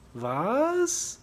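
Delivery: high, startled squeak of a question; a puzzled little whinny; timid whinny. Acted. German officer peering over trench, whinnying in surprise. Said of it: "whinnied the officer".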